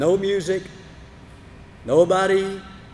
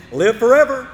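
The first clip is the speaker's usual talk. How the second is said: shouting up; 6 semitones higher, 7 dB louder than usual. Acted. Daniel shouting up to king.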